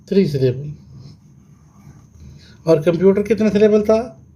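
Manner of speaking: normal talking voice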